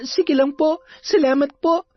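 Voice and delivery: put-on very high, polite girlish voice; high-pitched, respectful young girl; worried young girl. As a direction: confide family worries to an elder as a child. Young girl worries about family.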